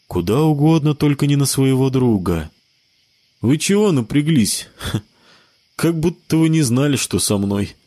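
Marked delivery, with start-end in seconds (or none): 3.4-7.7 light laugh, higher pitch; chuckling through the question; with a chuckle. Acted. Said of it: "со смешком спросил Джон".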